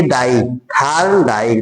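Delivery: थार्न डाई said slowly, each part held apart; teacher slowly demonstrating थार्न डाई; slow sounding out. Shown as a demonstration. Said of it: "डाई, इसमें sound आ रहा है".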